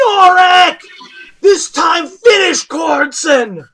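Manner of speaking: high, loud shout from the crowd; fan yelling. Acted. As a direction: scream it over the noise.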